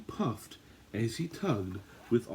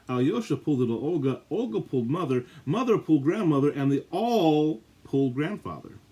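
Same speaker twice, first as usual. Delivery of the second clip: faster, growing louder toward the big pull; building excitement; 4 semitones higher, 6 dB louder than usual. A narrator's reading. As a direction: build the chain to a climax.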